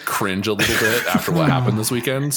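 laughing while teasing; giggling through the words. Real, not acted. Laughing at Matt's team.

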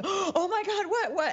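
high excited gasp, laughing. Retold or imitated. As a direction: squeal it out in disbelief.

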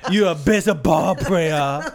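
slow, over-the-top fake Chinese accent; mock Chinese accent. Co-host pretending to address dreamed caller, mock Chinese accent. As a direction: draw out a caricatured Chinese accent.

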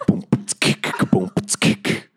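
vocal kick-drum and click pattern; mouth-percussion beat; beatboxing. Shown as a demonstration. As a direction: demonstrate a secret code as beatbox. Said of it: "it's just beatboxing, man".

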